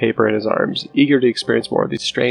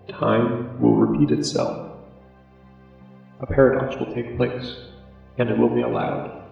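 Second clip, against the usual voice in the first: slow, soft, eerie note voice; 5 dB quieter than usual. Acted. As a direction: read it like a ghostly prophecy. Reading the final paper note.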